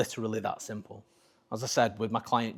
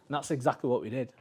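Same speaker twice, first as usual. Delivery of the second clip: usual speaking voice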